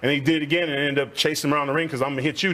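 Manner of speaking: ordinary talk, nothing marked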